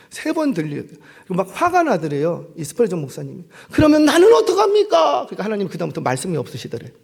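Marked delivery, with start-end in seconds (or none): 3.7-5.3 high, loud frustrated cry; indignant outburst; exasperated protest. Acted. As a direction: demand an answer from God in frustration. Angry Spurgeon argues with God.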